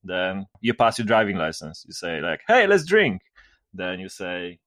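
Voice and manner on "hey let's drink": cheerful toast. Retold or imitated. Friends toasting driving license.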